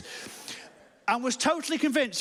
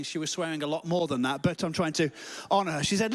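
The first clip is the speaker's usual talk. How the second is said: normal talking voice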